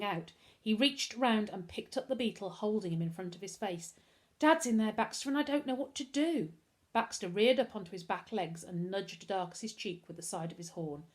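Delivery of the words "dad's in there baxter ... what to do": high, helpless voice; a touch of desperation, raised pitch; distressed. A narrator's reading. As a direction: confide in panic, pitched up. Boy helpless, confides in his beetle.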